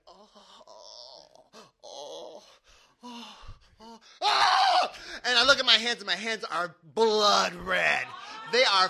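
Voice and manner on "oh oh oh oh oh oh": moaning then crying out. Retold or imitated. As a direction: groan in pain, then break into a wail. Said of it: "I'm just going".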